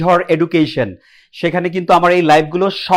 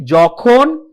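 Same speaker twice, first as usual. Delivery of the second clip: ordinary talk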